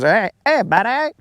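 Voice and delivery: high, light "hey hey buddy" in a put-on English lilt; mock English accent. Teasing Colin about his English accent, mock English greeting. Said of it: "when he talks, he goes".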